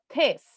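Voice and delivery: "this" with an open "eh" vowel, as "tes"; the vowel in "this" turned to a Spanish E; strong E. Shown as a demonstration. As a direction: say "this" like "tes", stressing the E. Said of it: "hago más fuerza en la E".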